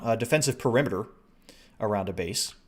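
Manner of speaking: ordinary talk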